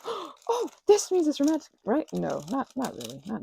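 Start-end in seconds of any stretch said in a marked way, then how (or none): 0.0-2.1 high, loud mock giddy thrill; giddy brain voice. Brain misreading kindness as romance, mock giddy. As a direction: play the brain jumping to romance, hammed-up. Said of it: "your brain goes".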